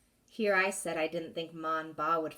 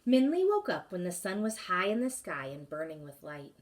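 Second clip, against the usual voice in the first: usual speaking voice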